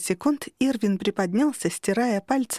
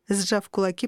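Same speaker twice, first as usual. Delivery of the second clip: ordinary delivery, nothing marked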